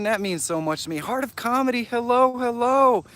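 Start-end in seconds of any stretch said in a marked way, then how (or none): 1.0-3.0 excited sing-song greeting. Real, not acted. Greeting a viewer in chat.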